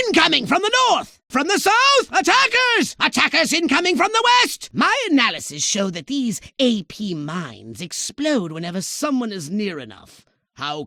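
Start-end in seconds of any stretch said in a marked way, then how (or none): 0.1-4.7 frantic lookout shouting attack directions; alarmed warnings. Acted. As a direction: call out the threats in rising panic. Warning of attackers from all sides.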